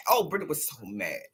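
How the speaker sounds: ordinary talk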